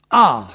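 loud sharp angry ah; angry cry. A narrator's reading. Husband crying out in anger at his wife. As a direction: bark out the ah. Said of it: "s'écria le mari".

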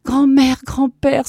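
high, loud emotional outcry; acted sobbing. Grandson embracing his grandparents, acted sobbing cry. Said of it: "sanglotait David Lustig".

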